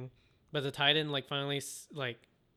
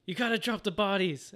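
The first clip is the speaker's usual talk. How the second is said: higher, emphatic mock command; raised-pitch, urgent retold order; insistent repeated order; 6 semitones higher, 3 dB louder than usual. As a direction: press the order on a disbelieving listener. Order repeated after a disbelieving "What?.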